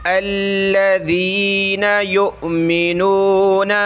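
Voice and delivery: slow, drawn-out Quranic recitation. Shown as a demonstration. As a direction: recite each syllable slowly and clearly.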